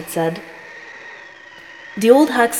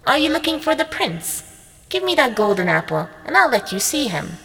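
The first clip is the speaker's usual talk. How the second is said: high, sly troll voice; troll Longnose; 6 semitones higher than usual. A narrator's reading. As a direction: tease her, high and sneaky.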